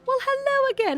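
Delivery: high sing-song hello; imitated high-pitched gleeful greeting; delighted greeting. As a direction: trill it very high, in playful delight. Retold greeting to Lennon's sister.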